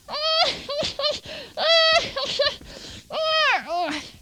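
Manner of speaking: big laugh, pitch up; laughing hard; hearty laugh at own joke. Real, not acted.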